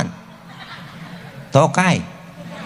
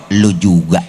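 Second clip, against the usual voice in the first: flat comeback; 9 semitones lower, 4 dB louder, slower than usual. Retold or imitated. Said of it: "balikin aja".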